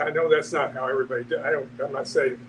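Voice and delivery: ordinary talk, nothing marked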